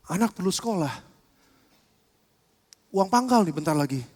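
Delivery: gentle, intimate roleplay of teacher and disciple; quiet acted dialogue. Acted Jesus and Peter dialogue.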